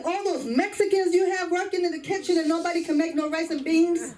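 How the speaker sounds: high, incredulous father voice; father's outburst. Retold or imitated. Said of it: "My father said".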